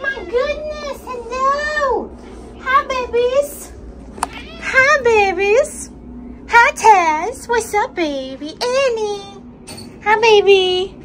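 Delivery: sing-song cooing at beloved pets; excited pet greeting. Real, not acted. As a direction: gush hellos in a high, drawn-out voice. Greeting shelter cats on arrival.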